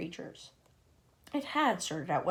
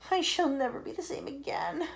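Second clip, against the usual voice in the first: ordinary delivery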